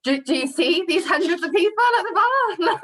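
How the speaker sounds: high-pitched put-upon bartender retort; hammed-up overwhelmed reply; mock exasperation. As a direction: act out a swamped bartender answering back, voice pushed up high. Acted reply to customers when swamped.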